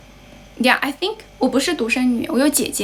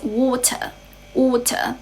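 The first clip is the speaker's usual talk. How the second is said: British "water", crisp t and no final r; British accent; 2 semitones higher, slower than usual. Shown as a demonstration. Teacher contrasting accents for learners, British accent. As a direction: say "water" as British "waw-tuh". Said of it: "in British English".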